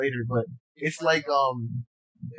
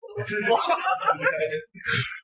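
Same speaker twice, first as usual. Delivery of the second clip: maniacal laugh. Retold or imitated. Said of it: "laughing like a lunatic".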